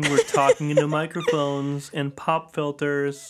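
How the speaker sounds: slow lightly done Trump impression. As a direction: drag it out like Trump speeches. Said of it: "It sounds like you're doing a Trump voice".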